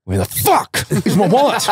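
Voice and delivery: high exasperated outcry with a laugh; hammed-up final stage of losing a wallet; alarmed outburst. Friend re-enacting wallet panic for laughs, exasperated. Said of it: "there's, like, three stages".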